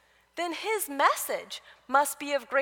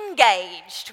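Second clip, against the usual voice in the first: imitating the trainer's "engaged", louder; playful echo of the coach's "engaged", called out; mock trainer shout; 4 semitones lower, 9 dB louder than usual. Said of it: "we echo him".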